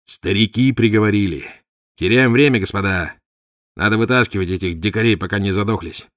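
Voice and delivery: loud, anguished outcry; pained exclamation. A narrator's reading. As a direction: cry out in pained urgency. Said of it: "с болью воскликнул". (с болью восклицая)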